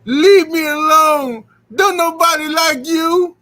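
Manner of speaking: high, slow, loud mocking girl voice; mock schoolgirl putdown. Mocking the girl who rejected him in the lunchroom, high and slow. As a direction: stretch it out high like a snotty kid. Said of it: "came over to me and said".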